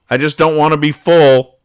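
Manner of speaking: put-on bratty child rising to a squeal; whiny kid complaint. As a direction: let the kid's complaint climb into a squeal. Dennis complains about dinner.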